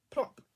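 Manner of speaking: short, loud plop; landing plop. A narrator's reading. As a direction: pop out the sound of a landing. Landing noise in the story.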